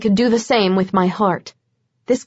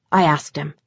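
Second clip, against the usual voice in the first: usual speaking voice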